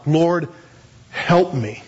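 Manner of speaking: simple, heartfelt plea; hurting plea. Retold or imitated. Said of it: "when you're really hurting".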